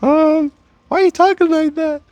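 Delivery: put-on high, loud falsetto girl; girl's voice. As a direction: falsetto girl questioning his voice. Girl's reply in acted pickup.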